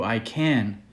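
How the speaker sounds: plain reading voice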